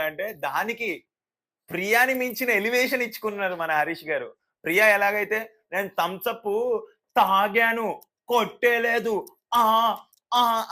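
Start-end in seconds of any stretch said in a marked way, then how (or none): 5.7-10.7 high, loud, slow worked-up denial; imitating Priya. Narrator mocking Priya's heated denial, higher and louder. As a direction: shake with mock outrage at the accusation. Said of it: "అని ఊగిపోయారో".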